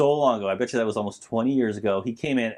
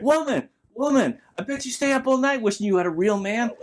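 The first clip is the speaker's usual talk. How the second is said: Clubber Lang taunt; 11 semitones higher than usual. Retold or imitated. Clubber Lang taunting Rocky's wife, mock Mister T voice. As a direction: sneer it at Adrian.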